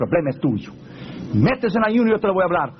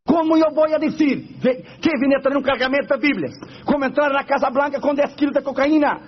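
panicked protest; 8 semitones higher than usual. Retold or imitated. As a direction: beg out of it in a high voice. Pastor protests God in panic.

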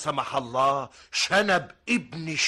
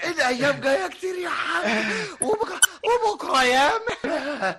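laughing excuse; 13 semitones higher than usual. Acted.

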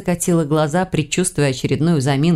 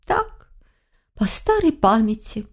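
feigned innocence; 5 semitones higher, slower than usual. A narrator's reading. Witch excuses her flirting to her granddaughter, innocent. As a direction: play innocent, light and higher. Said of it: "невинно хлопнула ресницами ведьма".

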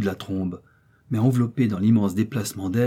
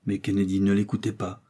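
plain reading voice

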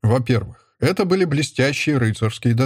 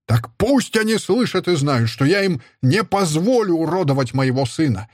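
high, raging command; angry order; 7 semitones higher than usual. A narrator's reading. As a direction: order it thrown out now. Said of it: "приказал он". (гневный приказ, высоко)

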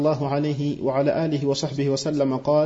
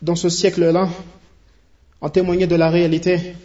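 rising, louder sermon voice that swells toward the end; fervent preaching; 3 semitones higher, 4 dB louder than usual. Acted. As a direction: preach it with growing force to the brothers. (prêche de plus en plus fervente)

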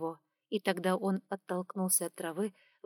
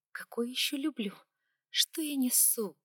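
fast agitated whisper; flustered whisper; 5 semitones higher than usual. A narrator's reading. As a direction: whisper it, embarrassed. Flustered inner thought.

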